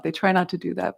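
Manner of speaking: ordinary delivery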